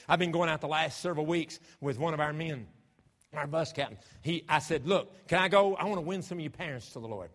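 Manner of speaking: loud, high fervent sermon voice; preaching. Real, not acted. Preacher telling of going out with the bus captain, fervent. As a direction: press the point hard on the listeners.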